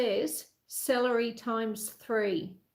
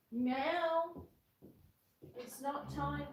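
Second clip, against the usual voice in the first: ordinary talk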